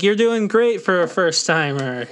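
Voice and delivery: high, sing-song praise, talking down; patronizing. Retold or imitated. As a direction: pat them on the head with it. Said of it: "made it sound so patronizing".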